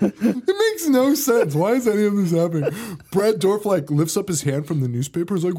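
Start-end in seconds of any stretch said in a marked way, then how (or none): 0.0-2.7 high, laughing protest; laughing disbelief. Real, not acted. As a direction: laugh through the outrage. Laughing exasperation at movie.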